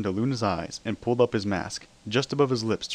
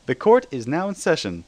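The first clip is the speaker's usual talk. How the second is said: louder, higher, a princess booming out; 6 semitones higher, 5 dB louder than usual. A narrator's reading. Celestia opens the trial to the courtroom, booming. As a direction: open court like a ruler with a booming call. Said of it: "Celestia's voice boomed".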